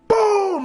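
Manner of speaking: loud, high boom for the slam; loud door boom. Retold or imitated. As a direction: shout the slam sound. Sound of slammed door.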